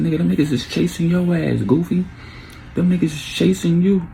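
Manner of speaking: high, exasperated telling-off; retold scolding. Man berates his cousin who drew the shooter, exasperated. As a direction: get it through his head.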